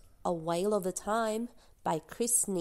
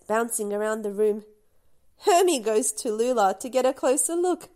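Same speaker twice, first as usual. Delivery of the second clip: ordinary delivery, nothing marked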